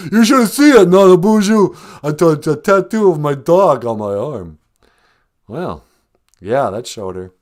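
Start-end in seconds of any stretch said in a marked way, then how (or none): 0.1-4.6 high, loud boxer brag; loud boasting. Acted. Film star bragging of his dog tattoo, loud boast. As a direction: holler it proudly, easing off toward the end.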